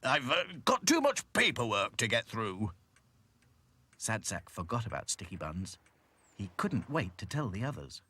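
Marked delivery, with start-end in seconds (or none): 0.0-2.7 flustered, louder excuse; spluttering excuse. A narrator's reading. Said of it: "he spluttered".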